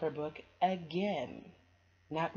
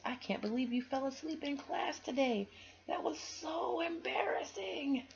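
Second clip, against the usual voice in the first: teasing little brother; 11 semitones higher than usual. Acted. Brother teasing sister on walk home, high whiny. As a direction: stretch out the embarrassment like a kid.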